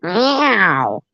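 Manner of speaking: high drawn-out meow; mock kitty meow; cat meow. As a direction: call like a cat to get the cats going. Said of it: "Get those cats going".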